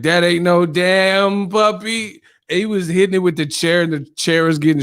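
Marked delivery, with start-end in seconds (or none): 0.0-2.2 slow, raised-pitch mock alarm; drawn-out exclamation. Martin reacting to the big rat, drawn-out mock alarm. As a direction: stretch the words out high. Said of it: "he's like".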